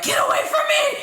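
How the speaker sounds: high, loud mock yell, laughing through it; retold shout. Woman reenacting yelling at her boyfriend, loud and laughing. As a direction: yell it like you did that night, half laughing. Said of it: "I went into the hospital and was like".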